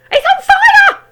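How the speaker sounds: shrieked very high and loud; yelling grandma. A narrator's reading. Grandma yelling as the hen smokes. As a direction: scream the alarm. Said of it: "Grandma yelled".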